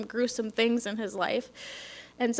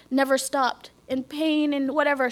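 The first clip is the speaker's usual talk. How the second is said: usual speaking voice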